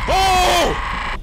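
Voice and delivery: high, loud frightened yelp; real fright, shrieking Oh; startled scream. Real, not acted. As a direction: scream as the scare hits. Jumpscare in horror game.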